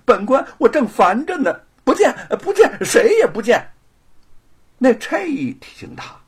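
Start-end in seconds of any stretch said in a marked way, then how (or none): 0.0-3.6 不耐烦地越说越响、越拔越高; 烦透了，把人赶走; 没好气. Acted. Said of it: "便没好气儿地说". (知府不耐烦地打发差役，越喊越响)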